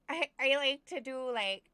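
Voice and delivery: loud, high-pitched Minnesota grandma accent. Shown as a demonstration. Gamer showing off the accents she does, as Minnesota grandma. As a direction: play a loud Minnesota granny, accent and all. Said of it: "Minnesota grandma".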